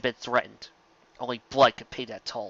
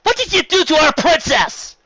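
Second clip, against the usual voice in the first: much higher, loud challenging shout; angry shout; 8 dB louder than usual. A narrator's reading. A brave mare challenges Nightmare Moon, loud high shout. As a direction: yell up at the villain. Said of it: "shouting at the intimidating".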